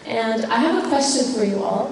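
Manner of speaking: ordinary talk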